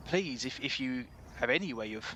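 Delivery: earnest West Country shopkeeper; worried, halting West Country pleading; West Country accent. Acted. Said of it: "best West Country accent this is".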